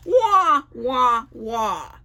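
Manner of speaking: loud brassy wah wah; trumpet imitation. Girl upstairs playing trumpet, imitated loud. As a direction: blast it like a trumpet. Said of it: "A girl is playing a trumpet".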